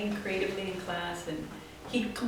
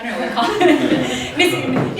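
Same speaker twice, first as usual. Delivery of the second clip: laughing; 6 semitones higher, 12 dB louder than usual. Real, not acted. Embarrassed about wording.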